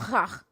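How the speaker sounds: "ha" opened with a raspy throat sound; guttural K. Shown as a demonstration. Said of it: "the K is gonna sound".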